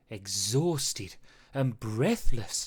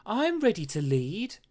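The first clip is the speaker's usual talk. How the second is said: high, bold and keen; eager boast; 7 semitones higher, 4 dB louder than usual. A narrator's reading. Pup boasting to the wolves, high and eager. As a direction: puff yourself up to lead.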